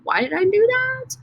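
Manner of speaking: playful rhetorical question; mock puzzled voice. Shown as a demonstration. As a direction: pretend to be the confused student.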